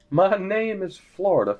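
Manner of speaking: ordinary talk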